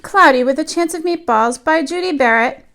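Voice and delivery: put-on lively read-aloud voice for young children; storybook reading. A narrator's reading. Teacher reading a picture book to her class, lively storytelling. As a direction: tell the tale to the class as if they sit on the rug.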